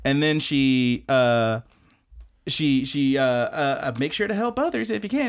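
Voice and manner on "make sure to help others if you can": high, quick chirpy voice; high chirpy schoolgirl; cheerful anime girl. Retold or imitated. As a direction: chirp it like a motto. Karen reciting her motto.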